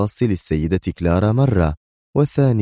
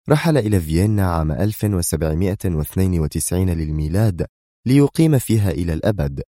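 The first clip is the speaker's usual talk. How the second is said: usual speaking voice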